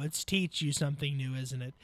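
host corrects his own joke grammar softly; self-correcting aside. Real, not acted. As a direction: fix the slip under your breath. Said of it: "I know".

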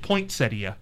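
"poinsettia" said point-SET-ee-uh, with a T after poin; misspelt with T. Retold or imitated. Host recounts a shop sign's misspelling, point-SET-ee-uh with a T. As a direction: say "poinsettia" as if spelt point-settia. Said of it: "They had a T".

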